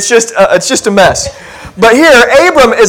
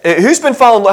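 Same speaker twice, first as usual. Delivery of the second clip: usual speaking voice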